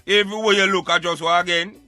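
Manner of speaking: ordinary talk, nothing marked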